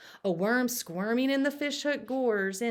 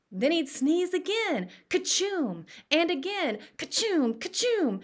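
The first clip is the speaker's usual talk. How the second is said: higher, building fake sneezes; mock sneezes; 8 semitones higher than usual. Joe playing Billy's peppered sneezes, mock sneezing. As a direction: sneeze it out with relish.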